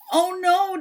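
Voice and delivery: mock scared shriek; frightened scream. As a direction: yell in panic as the monster comes. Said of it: "Donald screamed".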